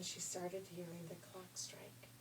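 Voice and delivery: suspenseful hushed whisper. A narrator's reading. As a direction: whisper it like a secret.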